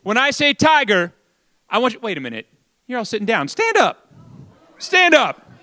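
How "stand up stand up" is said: rallying command. Real, not acted. MC orders seated crowd up.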